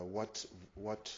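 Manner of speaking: ordinary delivery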